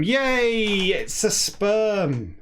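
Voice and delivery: high delighted yay; excited cheer. Real, not acted. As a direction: cheer it out gleefully. Mock celebration finding word.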